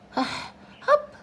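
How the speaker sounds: sudden squeaky hiccup; high hiccup. A narrator's reading. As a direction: let a tiny hiccup pop out high. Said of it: "said Roo accidentally".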